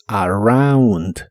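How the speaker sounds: "around" slowly with a clear au; "around" con au; ow sound demo. Shown as a demonstration. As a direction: draw out the au of "around". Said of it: "se pronuncia como au".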